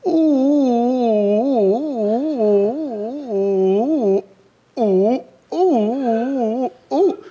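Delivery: loud, high mock whale calls; whale cries. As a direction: howl like a whale, hammed up. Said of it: "an impression of Christopher Walken".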